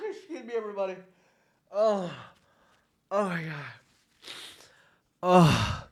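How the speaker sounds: soft, spent sighs after a real laughing fit; recovering from laughter. Real, not acted. Podcaster excusing self to everybody, worn out from laughing. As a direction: catch your breath, weakly excusing yourself between giggles. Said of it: "I'm hard as laughing".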